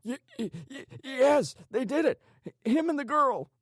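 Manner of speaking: very high, loud, stuttering panic; terrified stammer. A narrator's reading. Tortured man naming the killers, terrified. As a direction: blurt the confession through stutters.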